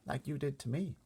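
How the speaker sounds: put-on little girl Sophie. Sophie asking the giant about the other giants, small high girl voice. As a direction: speak up like a worried little girl.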